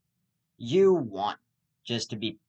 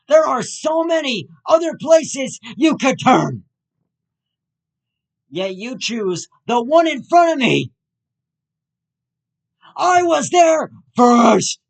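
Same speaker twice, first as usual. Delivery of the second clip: much higher, screamed road-rage yell; furious shout; 11 semitones higher, 12 dB louder than usual. Acted. Screaming at a driver who pulled out in front of him, furious shout. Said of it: "Headphone warning".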